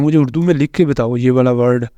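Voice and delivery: usual speaking voice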